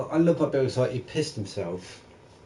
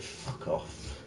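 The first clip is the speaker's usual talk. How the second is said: whispered retort; 7 semitones lower, 11 dB quieter than usual. Retold or imitated.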